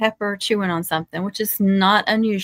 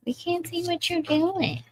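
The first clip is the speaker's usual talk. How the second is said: high, quieter whiny imitation; mock griping; 10 semitones higher, 5 dB quieter than usual. Host imitating viewers who can't see her work, mock griping. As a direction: mimic a complaining viewer in a high little voice. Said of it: "Someone's gonna gripe at me".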